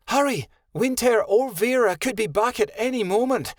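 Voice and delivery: high, urgent hurry; urgent cry. Acted. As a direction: call out urgently to the others. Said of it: "Kenai cried".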